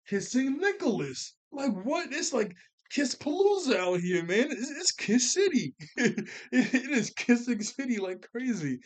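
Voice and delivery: high-pitched, incredulous exclaiming that breaks into laughter; amused disbelief. Real, not acted. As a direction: exclaim as if you can't believe it. Incredulous at all the kissing.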